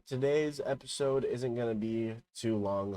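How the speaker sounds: normal talking voice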